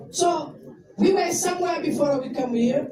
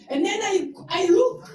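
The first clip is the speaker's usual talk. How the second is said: ordinary talk, nothing marked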